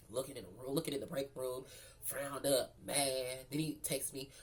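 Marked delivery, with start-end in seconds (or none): none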